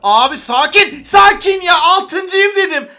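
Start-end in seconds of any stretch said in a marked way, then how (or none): 1.0-2.9 higher, louder excited shout. Real, not acted. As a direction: yell it out, thrilled to climb the ranks. Excited at reaching sixth place.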